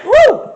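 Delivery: high loud woo after a sneeze; sneeze whoop. Real, not acted. Sneezes from the seasoning.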